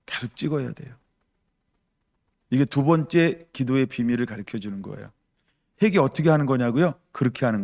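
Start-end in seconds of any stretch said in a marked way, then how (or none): none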